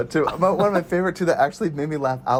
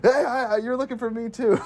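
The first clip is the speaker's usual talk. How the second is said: higher eager call; mock quote; 10 semitones higher than usual. Retelling a second hero stepping up, higher. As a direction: chime in eagerly. Quoting character.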